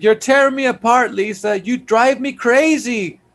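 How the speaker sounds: high-pitched melodramatic anguish; hammed-up high lament; imitating Johnny. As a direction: mimic an overacted lover's outburst, voice pushed up. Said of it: "He's like".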